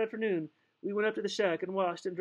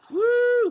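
high, loud "woo"; yelled whoop; 10 dB louder than usual. A narrator's reading. Japhie throws a flower at his friend, yelled whoop. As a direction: let out a whooping yell. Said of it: "he yelled".

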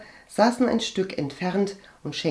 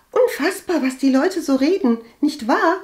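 high, loud, breezy brush-off; airily dismissive; 10 semitones higher, 6 dB louder than usual. A narrator's reading. Cousin brushes off her own gossip, airily. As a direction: wave it away in a high chatty voice. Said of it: "den Klatsch leichthin abzutun".

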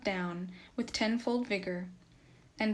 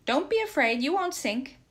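high, raised urging; lifted, louder voice, egging it on; crying out encouragement; 6 semitones higher, 6 dB louder than usual. A narrator's reading. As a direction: call out reassurance to a struggling horse. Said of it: "cried Nikita".

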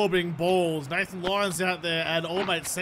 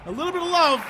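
affectionate repeat; 7 semitones higher, 3 dB louder, faster than usual. Shown as a demonstration. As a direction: croon "A little bit of love" up high and louder. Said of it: "Just needs a little bit of love".

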